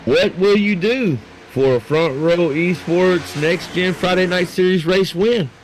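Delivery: slow, drawn-out promo question; announcer hype. Acted. Caster hyping the race prize to viewers, drawn-out announcer voice.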